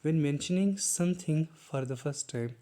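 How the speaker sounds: very slow, word by word, like dictating a definition; slow dictation. Shown as a demonstration. Teacher reading the definition slowly to students. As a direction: spell it out slowly for the class.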